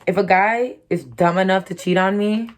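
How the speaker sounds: ordinary talk, nothing marked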